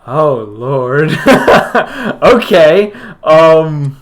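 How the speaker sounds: loud, high, breaking up with laughter; laughing hard. Real, not acted. Game master reacting to a player downing the vial, laughing loudly. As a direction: laugh helplessly through the words.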